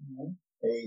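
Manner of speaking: ordinary delivery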